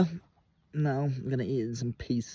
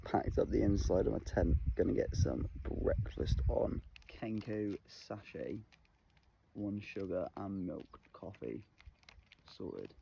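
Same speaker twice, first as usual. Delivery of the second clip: quiet morning voice; 3 semitones lower, 11 dB quieter than usual. Real, not acted.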